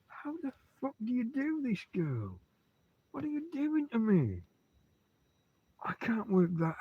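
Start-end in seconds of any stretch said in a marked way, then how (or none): 0.1-4.4 ranting at the dog. Real, not acted. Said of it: "ranting at the dog".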